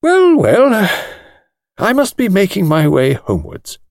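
put-on an old lawyer politely taking his leave; lightly voiced elderly gentleman bidding goodnight. Old lawyer leaving the club.

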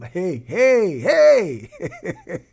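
escalating loud, high "hey"; church shout. Acted. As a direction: whoop it up, breaking into laughter. Imitating someone shouting in church.

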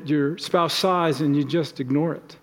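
normal talking voice

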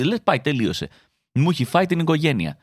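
ordinary talk, nothing marked

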